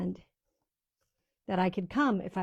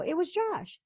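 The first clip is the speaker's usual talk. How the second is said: realizing; 10 semitones higher than usual. Retold or imitated. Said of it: "I go".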